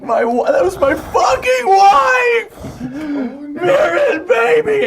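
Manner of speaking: very high, slow, wailing sob-shout; screaming and crying. Acted. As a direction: howl in grief for his wife. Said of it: "starts screaming and crying".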